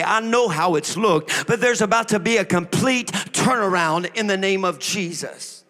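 loud, insistent, rhythmic sermon build; preacher's rousing proclamation; fervent preaching. Real, not acted. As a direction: drive each phrase home to the congregation. Preaches at full force.

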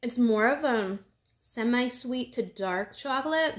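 speaking between bites; chewing while talking. Real, not acted. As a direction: mouth partly full. Seen 0: Holds bitten chocolate piece near mouth; lips pressed, chewing between words.

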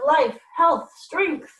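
loud, high ceremonial cry; ritual cry. A narrator's reading. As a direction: call it out like a chanting crowd. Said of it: "the cries of".

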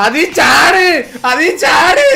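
high, loud yelling command; shouting to jump now; frantic shout. Real, not acted. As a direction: yell it at the screen.

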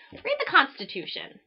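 normal talking voice

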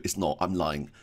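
usual speaking voice

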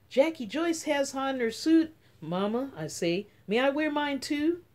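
eager asking. Acted. A girl eagerly asking her mother to go out in the rain. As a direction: beg hopefully to go out.

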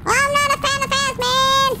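high squeaky objection; silly protest. Acted. Player objecting to fanning off, squeaky silly protest. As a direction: whine it up high in a put-on voice.

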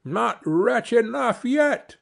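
high, slow, relentless taunt; high-pitched vengeful retort; taunting sparrow. Acted. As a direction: stretch it out in a high bird voice. Sparrow taunts the carter again.

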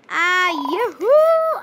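loud, high, slow teasing call; taunting yoo-hoo. Real, not acted. Teasing Jack after grabbing the card first, taunting call. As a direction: sing it out to tease.

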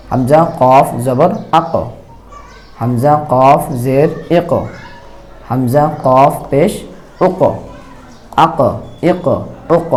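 slow spelling drill, each "আক", "এক", "উক" ending in a bounced stop; qalqalah drill. Shown as a demonstration. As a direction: bounce the final ক out with a small push. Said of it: "একটু ধাক্কা দিয়ে পড়তে হবে". (কলকলা করে ধীরে উচ্চারণ)

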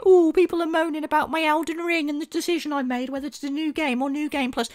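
high-pitched whiny imitation; mocking a complaining streamer; mock moaning. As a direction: moan it in a high put-on voice. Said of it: "some fucking loser moaning".

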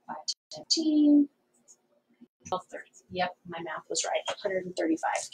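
quietly muttering the sums to herself; counting under breath. Real, not acted. Working out sum under breath.

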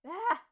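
a sudden high, loud cracked yelp; the voice breaks into a high yelp mid-word; startled yelp as the voice gives out. Real, not acted. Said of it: "my voice!".

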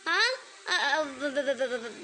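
low, stuttering syllables in fright; frightened stammer. Acted. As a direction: stammer helplessly, scared stiff. Child caught, stammers in fear.

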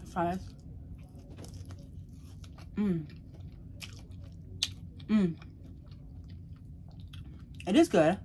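chewing while talking; biting food mid-word; mouth full of rib. Real, not acted. Seen 0: Rib held at mouth, teeth biting meat during the words.

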